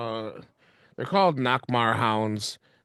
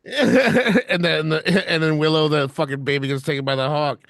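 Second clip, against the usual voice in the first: loud, high, laughing through the words; real laughing outburst; laughing excitement; 6 semitones higher, 9 dB louder than usual. Real, not acted. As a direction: crack up while excitedly piecing it together. Cracking up at the shared realisation.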